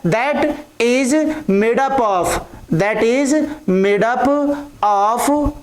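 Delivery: very slow, word-by-word dictation. Real, not acted. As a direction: dictate slowly, pausing between phrases. Dictating a definition for writing down. (धीरे-धीरे लिखवाते हुए)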